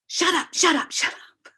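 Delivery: high-pitched mock yell, held back in volume; high, repeated retold outburst; imitated agitated outburst. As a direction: imitate someone telling voices to shut up. Boy suddenly sits up, outburst repeated.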